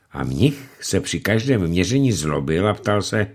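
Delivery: ordinary delivery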